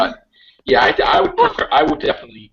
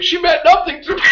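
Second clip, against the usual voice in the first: high, loud, crying plea; Doctor pleading with his wife, high hammed-up wail; mock desperate plea; 4 dB louder than usual. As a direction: beg her like a caught husband, hammed-up. Doctor caught, begs his wife.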